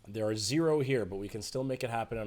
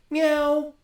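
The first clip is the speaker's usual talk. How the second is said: much higher and louder meow, really punched; the better second take of the cat meow; emphatic meow; 14 dB louder than usual. Shown as a demonstration. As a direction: give the meow more emphasis. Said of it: "put a little more emphasis on the meow".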